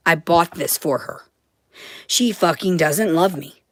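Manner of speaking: ordinary talk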